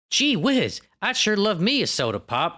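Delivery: high-pitched southern gee-whiz sitcom voice; kinda southern accent. Retold or imitated. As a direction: imitate a wholesome old TV family with a southern lilt. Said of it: "that accent was kinda southern".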